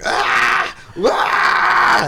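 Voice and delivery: loud imitated comedian scream; two big raw screams; mock screaming "Ah!" at full volume; Sam Kinison scream. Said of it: "the trailer was just ninety percent like".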